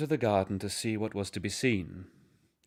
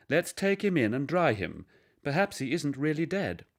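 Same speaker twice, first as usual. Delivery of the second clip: a gentle mother, pitched higher; lightly voiced mother; 6 semitones higher than usual. A narrator's reading. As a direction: soothe the boy as his mother.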